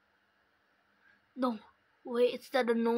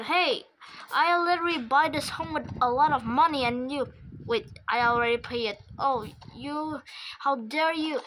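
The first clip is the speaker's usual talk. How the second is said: angry scolding; 3 dB louder than usual. Pretend. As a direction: rant at him for wrecking the house. Furious at the house destroyer.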